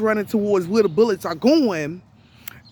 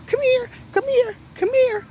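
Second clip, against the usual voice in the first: much higher sing-song voice calling a dog; high-pitched coaxing call to a pet. Retold or imitated. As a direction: imitate her sweet high voice for the dog. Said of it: "But she changed her voice".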